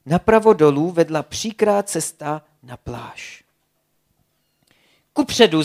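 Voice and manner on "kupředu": loud, higher roar forward; roared command. A narrator's reading. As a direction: roar the order to march. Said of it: "zařměl Blesk".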